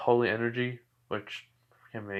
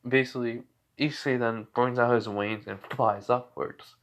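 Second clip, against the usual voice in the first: normal talking voice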